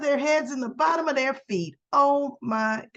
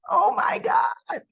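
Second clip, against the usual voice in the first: higher, slow, cry-like exclamation; overwhelmed outburst; 10 semitones higher, slower than usual. Real, not acted. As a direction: let it burst out, half laughing half crying. Reacting to absurd scene.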